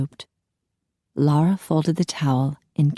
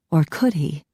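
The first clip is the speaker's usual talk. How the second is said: plain reading voice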